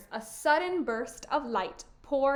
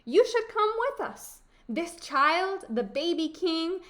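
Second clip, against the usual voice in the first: high, raised, urging voice; pitched-up, emphatic invitation; eager storybook boy; 5 semitones higher, 4 dB louder than usual. Acted. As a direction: urge her along like an excited boy. Boy urges Befana to come along.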